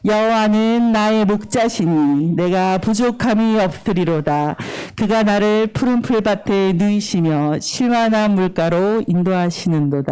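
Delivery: slow, measured verse for others to follow; slow recitation. Shown as a demonstration. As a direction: lead them through the verse slowly. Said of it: "천천히".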